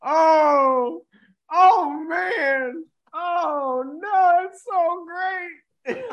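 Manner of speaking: words wailed through laughter; real helpless laughter. Real, not acted. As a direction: laugh so hard it sounds like crying. Cracks up at the wrestler reveal.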